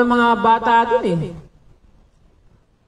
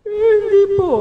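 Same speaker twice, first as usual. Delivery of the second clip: imitating a timid student; 12 semitones higher, 3 dB louder than usual. Student replies to teacher, retold.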